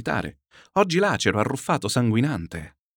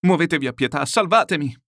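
salvatemi cried out higher and louder; desperate cry; 6 semitones higher, 5 dB louder than usual. Acted. Chichikov clutching Murazov's hand, cries salvatemi in despair. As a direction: beg for mercy, rising to a cry. Prisoner begs to be saved.

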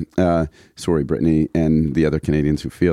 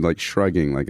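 ordinary talk, nothing marked

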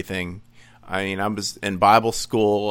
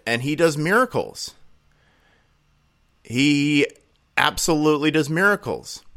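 ordinary talk

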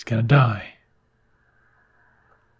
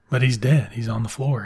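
ordinary talk

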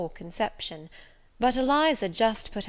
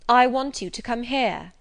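bossy order; 4 semitones higher, 7 dB louder than usual. A narrator's reading. Bully orders her over.

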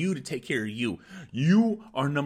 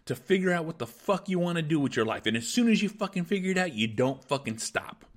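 angry motivational rant; 3 semitones higher than usual. Acted. Podcast host firing up his listeners, fierce angry pep talk. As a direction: fire them up like a furious coach.

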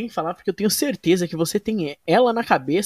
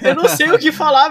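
laughing; 8 semitones higher, 9 dB louder, faster than usual. Real, not acted. Laughing at lack of topics.

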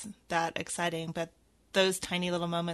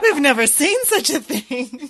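mock amazement; 5 semitones higher, 6 dB louder than usual. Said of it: "joining the joke".

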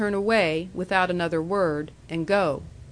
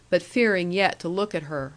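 plain reading voice

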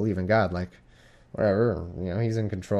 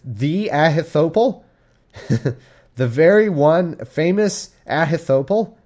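slow, higher, louder mock-impressed question; mock awe; 7 semitones higher, 6 dB louder, slower than usual. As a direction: ham up being starstruck. Mock awe at famous name.

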